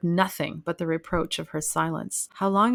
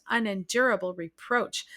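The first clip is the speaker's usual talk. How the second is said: usual speaking voice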